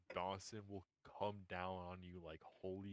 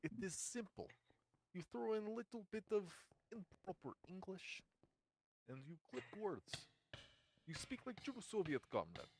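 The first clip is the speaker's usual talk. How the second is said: hammed-up Russian accent, higher; clipped-word Soviet comrade voice; 10 semitones higher, 4 dB louder than usual. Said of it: "Can you do Russian accent?".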